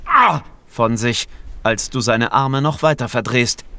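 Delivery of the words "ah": sharp high yelp of pain; pained cry. A narrator's reading. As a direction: cry out as the arm is twisted. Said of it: "gibt sogleich ein schmerzliches".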